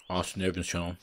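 usual speaking voice

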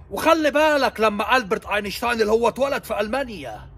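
ordinary delivery

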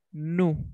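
"new" as a plain "noo"; American pronunciation. Shown as a demonstration. Teacher repeats the American form for the class, "new" as "noo". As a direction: drop the y-sound, "new" rhymes with "too". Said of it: "En inglés americano, americano, decimos".

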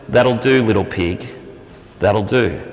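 a low, soft farmer's voice; gruff farmer. Retold or imitated. Preacher voicing the farmer praising his pig, low and quiet.

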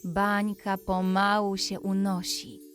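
usual speaking voice

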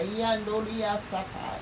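normal talking voice

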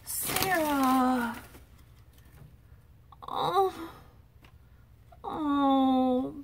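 awed surprise. Real, not acted. A vlogger overwhelmed by a friend's handmade gift, awed. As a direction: let the wonder pull each word out.

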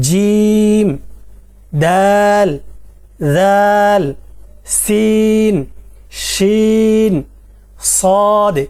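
each letter name held long on one steady pitch; three-alif stretch. Shown as a demonstration. Teacher demonstrating the long pull on each letter to students. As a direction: draw every name out for three alif lengths. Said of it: "তিন আলিফ পরিমাণ টান হবে".

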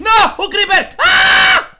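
high, loud startled scream; panicked shriek. Real, not acted. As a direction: yelp in panic at the creeper. Creeper appears, panic.

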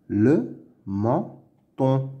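"Le menton" slowly as "le", "men", "ton"; syllable by syllable. Shown as a demonstration. Teacher sounding out words to read, "Le menton" in slow pieces. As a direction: pause after each syllable of "Le menton". Reading drill, split then blended.

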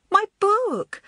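high, loud outraged cry; as the book lands in the puddle; furious cry. A narrator's reading. Girl cries out at the actress over her ruined book, furious. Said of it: "cried Elizabeth furiously".

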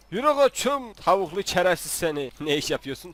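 ordinary delivery

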